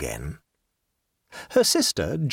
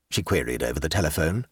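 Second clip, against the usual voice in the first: plain reading voice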